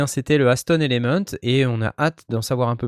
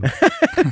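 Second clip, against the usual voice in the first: squealing high laugh. Real, not acted. Laughing at Red Room.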